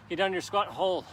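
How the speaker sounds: ordinary talk, nothing marked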